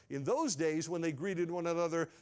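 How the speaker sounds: usual speaking voice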